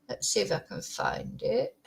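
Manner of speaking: whispered aside while searching; whispering to herself. Real, not acted. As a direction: breathe it out under your breath. Searching the screen, talking to herself.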